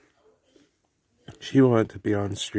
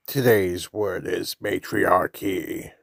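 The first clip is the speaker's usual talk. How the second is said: announcer voice; 2 semitones lower, slower than usual. Acted. Announcing word of day, slow.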